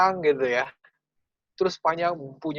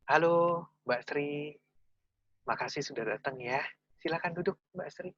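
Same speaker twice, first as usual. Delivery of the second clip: much softer, calm and gentle welcome; calm gentle interviewer; 7 dB quieter than usual. Retold or imitated. Steady interviewer inviting Sri to talk, soft and calm. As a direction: ease the candidate in softly. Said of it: "Masih enak ya cara nerimanya, tapi lebih kalem".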